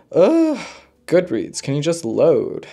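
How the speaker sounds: annoyed groan at the website; exasperated. Real, not acted. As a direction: huff at the slow page. Frustrated at slow website.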